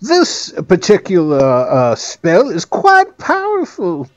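high, slow little gnome; high-pitched gnome wizard. Acted. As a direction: squeak it out like a pompous little gnome.

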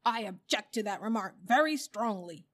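loud, emphatic objection; indignant bulldog. A narrator's reading. Bulldog objects to elephant's remark on noses, indignant. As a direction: protest firmly, with offended force.

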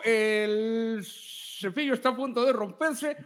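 high, lively review voice; TikTok reviewer voice. Pretend. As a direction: narrate it like a trailer. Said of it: "al estilo de Javier Ibarreche".

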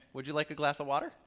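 loud, high, fast and grudging offer; begrudging hospitality, loud and quick; grumbling hostess. Shown as a demonstration. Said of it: "Well, that sounds different".

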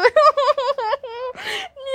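high, helpless laugh close to tears; squealing real laugh; real laughter. Real, not acted. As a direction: laugh until it nearly turns to crying. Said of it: "yo me reía".

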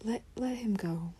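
unvoiced whispered release; soft whisper of letting go. Shown as a demonstration. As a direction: breathe it out softly like letting go. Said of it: "Let it go is like".

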